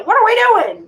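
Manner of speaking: playing a part who has no idea; mock clueless alarm. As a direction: pop up suddenly, confused. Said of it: "doesn't know".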